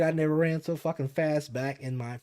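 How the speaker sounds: usual speaking voice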